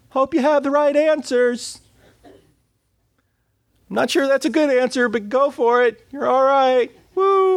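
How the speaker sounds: high sing-out from behind a door; distant cheering-on. Acted. Onlooker behind the door cheering someone on, high far-off call. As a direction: holler useless encouragement from way back, very high. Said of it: "the other side of the door".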